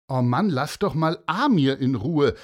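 loud fed-up protest; loud annoyed schoolgirl; annoyed girl. Acted. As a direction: tell the bully off. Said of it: "sagte plötzlich ein Mädchen".

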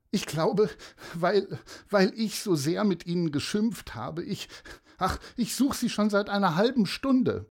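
high, upset mother's voice; distressed mother. Acted. Mother explaining that the children ran away, distressed.

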